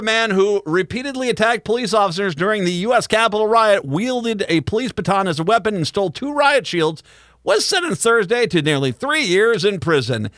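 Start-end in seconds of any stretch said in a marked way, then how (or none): none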